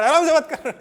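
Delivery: high, very loud mock scolding; shouting. Shown as a demonstration. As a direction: yell it for comic contrast. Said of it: "चिल्ला कर". (ज़ोर से चिल्लाने का नाटक)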